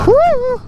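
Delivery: high joyful holler; excited whoop. Real, not acted. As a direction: whoop with riding joy. Cheering while riding.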